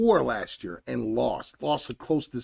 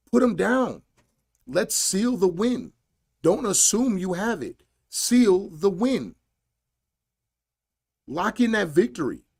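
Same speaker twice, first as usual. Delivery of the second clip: higher, louder, each order hammered; fired-up coach demanding the finish; urgent coaching commands; 6 semitones higher, 4 dB louder than usual. Real, not acted. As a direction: shout instructions from the corner. Frustrated rant at fighter.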